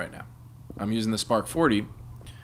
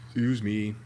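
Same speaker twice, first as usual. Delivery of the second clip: ordinary delivery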